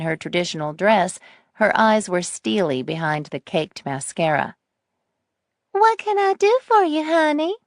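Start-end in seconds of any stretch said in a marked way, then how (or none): 5.7-7.6 put-on high, syrupy sweet old lady; sugary sweet grandma voice. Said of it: "Her voice was syrupy sweet".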